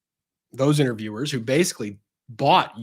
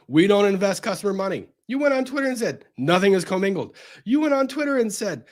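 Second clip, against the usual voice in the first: high-pitched exasperated rant; incredulous rant; 6 semitones higher than usual. Acted. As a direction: lay into him in disbelief. Ranting at SBF.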